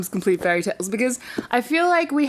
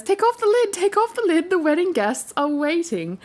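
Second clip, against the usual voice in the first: little hare voice; 7 semitones higher than usual. Acted. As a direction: sing it out like an eager little bunny.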